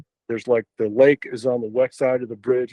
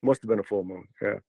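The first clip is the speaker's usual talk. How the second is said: ordinary delivery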